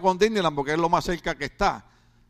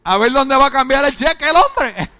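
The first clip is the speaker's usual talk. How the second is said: laughing; 5 semitones higher, 7 dB louder than usual. Real, not acted. Laughing at own joke.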